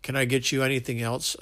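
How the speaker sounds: usual speaking voice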